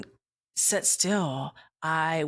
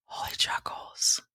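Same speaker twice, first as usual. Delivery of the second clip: soft whisper of God naming it; whispered divine voice; 4 dB quieter than usual. Retold or imitated. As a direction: whisper it like a revelation. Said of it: "God was like".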